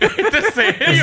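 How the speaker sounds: very high, slow, cracking-up question; incredulous laughter. Real, not acted. Host repeating the question to colleagues, helpless laughing disbelief. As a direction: squeal it through laughter, stretched out.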